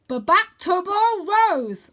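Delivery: high, loud, slow commanding call. A narrator's reading. Arthur commanding Vlad to fly to Rose, loud command. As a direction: order the dragon home. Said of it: "I command".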